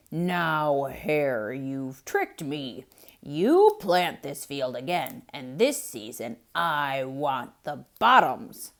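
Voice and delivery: loud, slow, aggrieved bear voice; a tricked bear protesting to Hare; complaining bear. Acted. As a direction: grumble it out slowly, feeling cheated. Bear demands.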